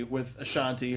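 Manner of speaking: ordinary talk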